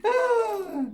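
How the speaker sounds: very high, laughing and teary, rising hellos; high cutesy greeting; giddy squeals. Real, not acted. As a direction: coo at the stones like puppies. Laughing, greeting crystals cutely.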